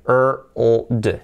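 R, O, D separated as er, ull, d; sound by sound. Shown as a demonstration. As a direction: spell out the sounds one at a time. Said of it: "primero la R".

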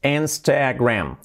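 "Instagram" slowly drawn out as "ens-te-gram"; slow teaching take of "Instagram"; slow model. Shown as a demonstration. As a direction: stretch "Instagram", first vowel e. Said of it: "La I, una E".

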